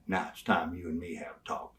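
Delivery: low, soft unvoiced whisper; hushed menace. Manager finally turning to Brian, low menacing whisper. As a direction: lean in and whisper the threat.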